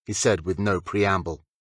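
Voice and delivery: ordinary delivery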